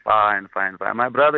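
usual speaking voice

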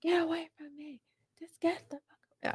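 high, hushed mock scream; stifled, high-pitched imitation of a yelling sister; imitated top-voice yell. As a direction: mimic screaming at someone, but keep the volume down. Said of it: "at the loudest top of a voice".

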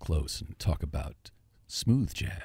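low, hushed smooth-jazz radio voice; soft and deep, playing seductive into the mic; mock sexy. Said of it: "Talk to me sexy".

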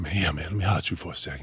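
lightly voiced urgent request; hushed quick request; low, fast hushed ask. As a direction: mutter it quick and serious. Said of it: "I said".

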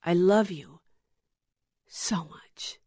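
love you, so much whispered; love you fading to a whisper. Acted. Jesus telling the viewer he loves them, trailing into a whisper. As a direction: let so much drop into a whisper. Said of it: "what Jesus tells you".

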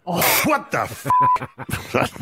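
high, slow, laughing disbelief; stunned laugh at the joke; shocked laughing. Real, not acted. Shocked by the name Dick Seaman.

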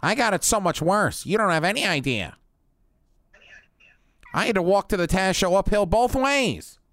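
high, hammed-up complaining; mock grumpy veteran. Host mocking his co-host's hard old days, high-pitched grumble. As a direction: moan about how hard you had it.

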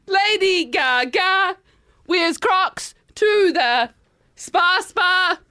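slam poetry. Acted. Host performing her Crocs poem, slam poetry. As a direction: draw out each phrase as verse. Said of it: "so it's in that style".

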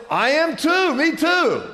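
high, loud, eager acted reply; thrilled to find common ground; hammed-up joke-teller excitement; excited agreement. Passerby delighted to find common ground.

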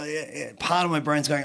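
usual speaking voice

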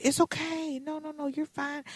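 high, soft, gentle reassurance; calm a crying fan kindly. Retold or imitated. Jarrett soothing a crying fan, softly.

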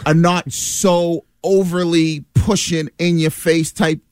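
ordinary talk

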